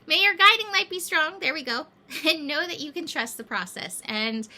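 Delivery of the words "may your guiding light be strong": uplifting blessing. Real, not acted. Blessing lifted with laughter.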